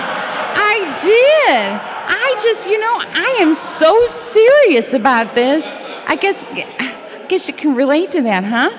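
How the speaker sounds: high, fired-up and half-laughing; confessing a long grudge to the group, high and worked up; worked-up confession. Real, not acted. Fired-up rant about old resentment.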